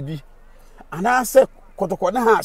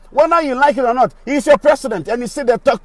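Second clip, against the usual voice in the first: loud, high, heated scolding; loud, high scolding of a viewer who dismissed him; angry shout; 7 semitones higher, 6 dB louder than usual. Real, not acted. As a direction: tell him off hard. Angry retort to an online commenter.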